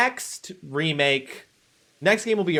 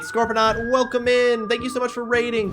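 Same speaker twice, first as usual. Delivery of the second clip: excited greeting; 9 semitones higher, 5 dB louder than usual. Real, not acted. Streamer greets incoming raid.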